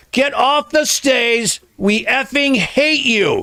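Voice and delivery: slow, high-pitched mock heckle; high, stretched-out retold jeer; imitated woman heckler. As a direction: draw out every word like an outraged heckler. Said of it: "A woman goes".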